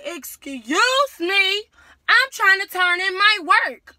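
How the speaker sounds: yelled high and very loud, stretching "Excuse"; furious classroom shout. Retold or imitated. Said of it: "I was real loud then".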